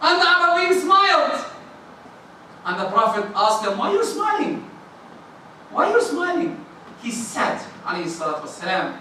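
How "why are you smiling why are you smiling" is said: high, softly curious; gentle curious question. Acted. Prophet asking the smiling Bedouin, gently curious. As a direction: ask it gently and lifted.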